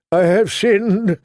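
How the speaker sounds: high, slow penitent wail. A narrator's reading. Captured Septon confesses his sins, wailing. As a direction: draw it out in a pleading wail. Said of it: "the Septon wailed".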